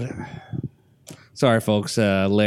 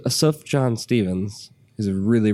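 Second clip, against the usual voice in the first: ordinary talk, nothing marked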